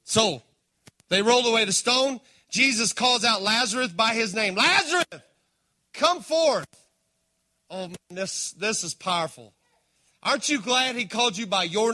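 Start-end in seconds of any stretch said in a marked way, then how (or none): 4.5-6.7 calling out. Acted. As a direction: summon him out with a ringing cry. Jesus calling Lazarus out.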